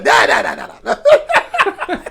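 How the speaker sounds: high, loud laughing refusal; helplessly amused mock protest. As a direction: cackle through the repeated refusals. Acted rejection of harsh sound.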